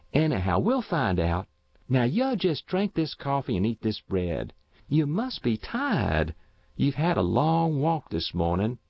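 high-pitched, motherly country coaxing; coaxing neighbour woman. Acted. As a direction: humour a confused old man and settle him down. Voices neighbour humouring old man.

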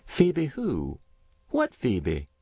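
a woman who can't place the name; Matilda's confused reply; puzzled Mrs. Race. A narrator's reading. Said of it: "replied Mrs. Race".

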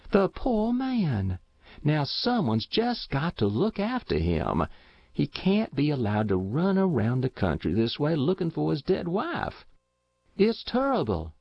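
high, distressed country woman; rustic housewife's dismayed pity; pitying neighbour woman. Acted. Voices neighbour lamenting alone.

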